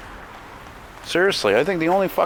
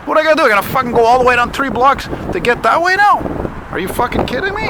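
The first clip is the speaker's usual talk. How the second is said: much higher and louder, fed-up yelling; real outrage, pitched way up; exasperated rant; 12 semitones higher, 5 dB louder, faster than usual. Real, not acted. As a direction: blow up at the endless fences. Ranting at construction fences.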